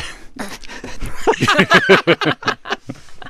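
airy unvoiced laugh; breathy laugh. Real, not acted. Teasing Bob as empty nester.

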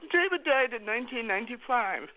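ordinary delivery

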